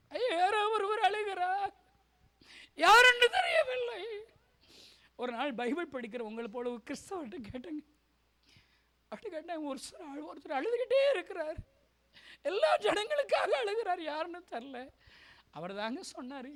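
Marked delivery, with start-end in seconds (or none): 0.1-4.0 high, drawn-out tearful wail; crying out. Retold or imitated. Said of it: "அந்த சத்தம் கேட்ட உடனே அழுவேன்". 9.1-14.8 imitating a high, tearful voice; tearful pleading. Re-enacts his tearful questioning.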